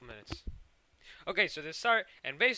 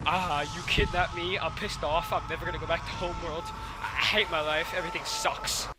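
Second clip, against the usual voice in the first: louder, exasperated outburst; mock angry rant; 3 semitones higher, 6 dB louder than usual. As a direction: imitate a pissed-off complaint. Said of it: "she's just pissed".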